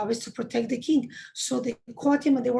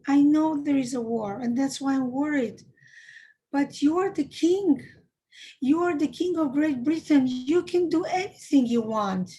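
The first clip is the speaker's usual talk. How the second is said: raised, insistent begging, slowing on the last pleas; pleading; 3 semitones higher, 4 dB louder than usual. Retold or imitated. Child begging the king to save his parents, earnest mock pleading. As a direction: beg urgently as the retold child. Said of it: "he kept on pleading".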